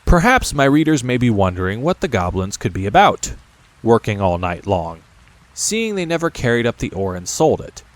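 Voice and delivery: usual speaking voice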